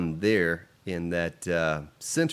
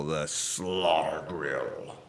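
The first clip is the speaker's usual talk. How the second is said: very slow, drawn-out, lower and sinking; hammed-up ominous voice; 4 semitones lower, 4 dB quieter, much slower than usual. Streamer naming the zombie grill, hammed-up ominous voice. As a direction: stretch it out like a mock horror title.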